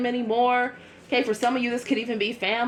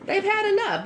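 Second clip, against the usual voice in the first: fed-up exclamation; 10 semitones higher than usual. Real, not acted. Repeats, pitch jumps.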